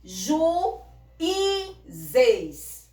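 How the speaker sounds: "Ju, i, zeis" em três partes, com o i bem agudo e sozinho; sílabas separadas. Shown as a demonstration. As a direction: separe "Ju, i, zeis", caindo forte no zeis. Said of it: "você separa a sílaba". ("Ju, i, zeis" silabado, com pausas)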